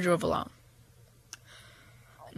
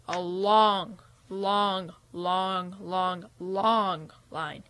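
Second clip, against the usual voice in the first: stretched out; 3 semitones lower, 7 dB quieter, much slower than usual. A narrator's reading.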